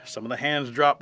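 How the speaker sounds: ordinary delivery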